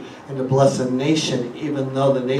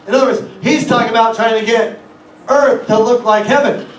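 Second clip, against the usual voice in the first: raised, driving pulpit voice; higher, louder sermon cadence building; full-force preaching; 7 semitones higher, 6 dB louder than usual. Acted. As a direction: preach it out loud to the congregation.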